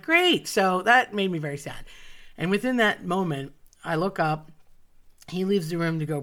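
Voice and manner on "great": high, loud one-word outburst; exasperated reaction. Retold or imitated. Child told she will miss the play, exasperated outburst. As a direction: burst out with it, sharply higher.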